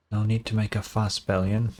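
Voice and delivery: ordinary talk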